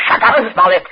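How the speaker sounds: loud, sharp command; harsh order. Acted. Prince snaps at his captive Smollett, loud and harsh. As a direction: silence him with a snap.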